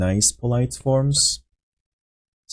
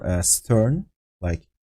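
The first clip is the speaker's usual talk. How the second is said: ordinary delivery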